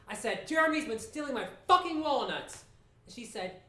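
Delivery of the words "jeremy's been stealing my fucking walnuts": exasperated complaint, pitched high; angry accusation. Retold or imitated. Man accuses kid to his mother, exasperated. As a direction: retort with indignation, voice climbing.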